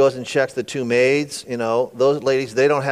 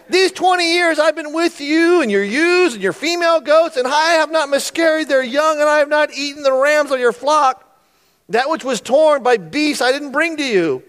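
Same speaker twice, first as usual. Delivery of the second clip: high-pitched, heated acted tirade; pent-up frustration; 10 semitones higher, 4 dB louder than usual. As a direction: pour out years of grievance. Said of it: "twenty years of frustration is about to come out".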